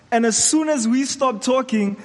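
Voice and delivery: ordinary talk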